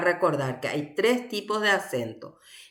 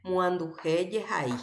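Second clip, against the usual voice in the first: ordinary talk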